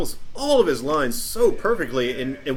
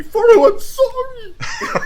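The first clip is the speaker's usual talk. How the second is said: much higher, loud cry, very slow and stretched; heartfelt screaming apology; 8 dB louder, much slower than usual. Retold or imitated. Friend imitating Boromir's anguished cry to Frodo, half laughing. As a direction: wail the apology with a laugh breaking in. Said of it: "heartfelt, screaming apology".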